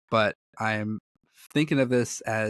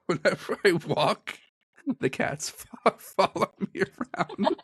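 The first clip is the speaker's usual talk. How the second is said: higher, giggly telling; laughing; 8 semitones higher than usual. Real, not acted. Telling co-host how cats follow the bells, laughing. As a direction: laugh your way through it.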